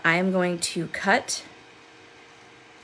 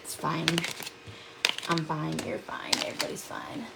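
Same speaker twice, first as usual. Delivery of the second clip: fading to whisper; 3 semitones lower, 9 dB quieter than usual. Pretend.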